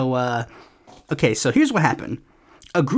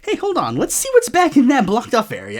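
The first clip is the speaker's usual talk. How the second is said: much higher, bright nosy voice; mock curious diplomat; 8 semitones higher, 3 dB louder than usual. Diplomats eyeing the blocked area, mock innocent high voice. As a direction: play the innocent snoop, pitched way up.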